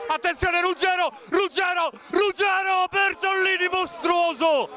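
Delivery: excited shout. Real, not acted. Near-goal, goalkeeper save.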